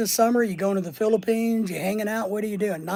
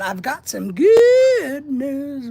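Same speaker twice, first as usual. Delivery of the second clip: slow, drawn-out teasing announcement, higher and louder; slow announcing voice; 6 semitones higher, 7 dB louder, much slower than usual. Retold or imitated. Reading Linda's chat message aloud in a slow, raised announcing voice. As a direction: reads the news out slowly like a big reveal. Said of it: "So Linda's saying".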